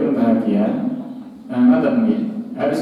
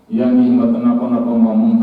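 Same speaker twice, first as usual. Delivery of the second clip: ordinary talk, nothing marked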